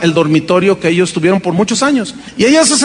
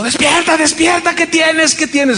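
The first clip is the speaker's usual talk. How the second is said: mock husband's yell; 8 semitones higher than usual. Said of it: "los gritos de mi esposo".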